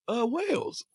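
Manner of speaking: high, faked British answer; imitated friend faking a Londoner; put-on London accent. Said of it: "trying to put on the accent".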